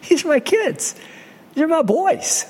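high-pitched mock protest; acted, high-voiced objection; protesting father. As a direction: play an indignant dad defending his children. Acts Samuel defending his sons.

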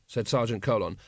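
ordinary delivery

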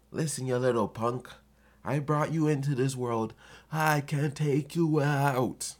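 hammed-up warning to a cheeky kid; mock angry parent. Parent threatening his own kid, mock angry. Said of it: "my kid's gonna look at my angry face".

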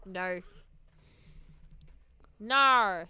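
practising an Australian-accented "No"; attempted Australian no. Shown as a demonstration. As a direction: try out the Australian vowel, not quite getting it. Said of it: "I can't do the no".

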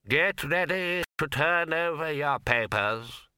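slow, high formal invigilator; exam proctor. Acted. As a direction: announce it to the hall. Invigilator announces.

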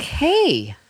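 high-pitched, wide-eyed caricature; Ricky. Acted. Host imitating a bandmate questioning someone's playing, wide-eyed impression. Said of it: "like, wide-eyed".

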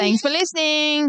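high, slow lilting thanks; sing-song sign-off. Real, not acted. Co-host thanks podcast listeners, sing-song farewell. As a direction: lift and stretch the words playfully.

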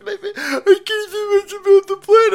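loud, squealing falsetto sob; hammed-up high-pitched wailing; mock crybaby. Host mimicking a helpless protester, mock crying.

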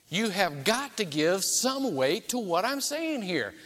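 ordinary talk

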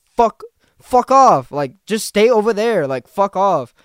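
loud, slow, angry growl of a warning; Putin telling off. Retold or imitated. Putin warning NATO off in a loud, slow angry voice. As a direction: bark it out as a fed-up Putin. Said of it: "Putin is kinda saying".

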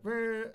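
short, high trilled brr; describing party blowers to the hosts, imitated blowing trill; party blower noise. Imitate a party blower. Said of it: "things where you go like".

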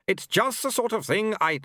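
high-pitched, firm nagging voice; firm scolding wife. A narrator's reading. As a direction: scold firmly, pitched well up.

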